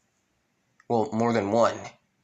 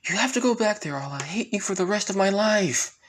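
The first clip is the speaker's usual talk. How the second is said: put-on much higher girl's voice, urgent; insistent sister; 10 semitones higher than usual. Sister ordering Gauche back, high insistent voice. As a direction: threaten him in a high-pitched plea. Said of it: "with his sister".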